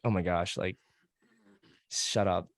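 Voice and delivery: ordinary talk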